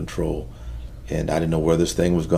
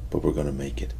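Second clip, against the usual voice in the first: hushed father; 3 semitones lower, 8 dB quieter than usual. Retold or imitated. Father at jail visit, phone.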